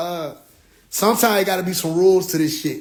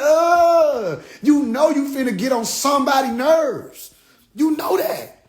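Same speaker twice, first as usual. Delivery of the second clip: exasperated shout; 6 semitones higher, slower than usual. Retold or imitated. Telling the woman she gets on nerves, high exasperated voice. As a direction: scold her high and exasperated. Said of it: "I said".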